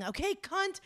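high mocking road-rage yell. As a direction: squeal it out like an angry driver. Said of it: "putting down my window and yelling".

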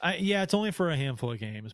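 ordinary talk, nothing marked

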